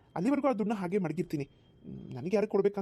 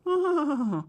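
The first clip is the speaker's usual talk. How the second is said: mocking laugh; 7 semitones higher, 3 dB louder, much slower than usual. Cruel father mocks.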